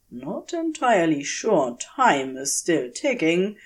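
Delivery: plain reading voice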